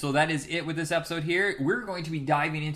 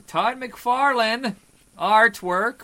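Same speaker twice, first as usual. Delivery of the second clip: drawn out loud and slow; slow emphatic; 8 semitones higher, 9 dB louder, much slower than usual. Real, not acted. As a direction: stretch the name out slowly.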